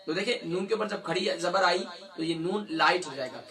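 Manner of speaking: ordinary talk, nothing marked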